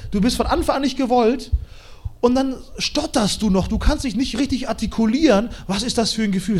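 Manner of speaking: usual speaking voice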